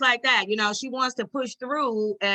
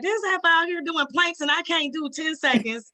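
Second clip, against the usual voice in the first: mock disbelief; 7 semitones higher than usual. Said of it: "I'm like".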